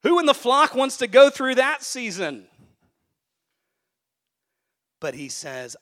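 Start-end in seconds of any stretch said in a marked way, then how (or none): 0.0-2.5 high, loud rhetorical cry; full-force preacher call; preaching shout. Acted. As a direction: belt the question out to the flock.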